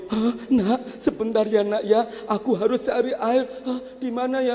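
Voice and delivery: high, hurried; anxious high muttering; anxious mother. Acted. Mother tells baby she must find water, high anxious.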